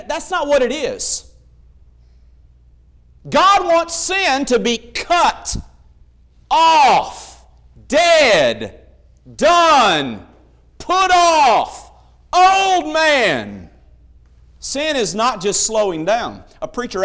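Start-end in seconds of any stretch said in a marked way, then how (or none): 3.3-13.6 each word hit hard and loud; forceful preaching. Real, not acted. Preacher to congregation, punching each word loudly. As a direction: pound out every word with pauses.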